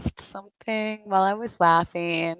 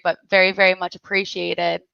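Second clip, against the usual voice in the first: ordinary talk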